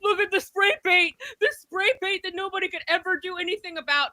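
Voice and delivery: high, whiny parody of a frightened newsman; mock scared reporter. Host mocks a reporter shaking on the line, high whiny parody. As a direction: squeak it out like a panicky reporter.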